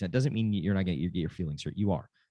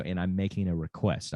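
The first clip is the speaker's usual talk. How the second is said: normal talking voice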